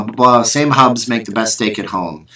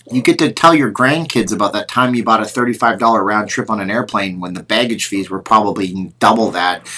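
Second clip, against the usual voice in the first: normal talking voice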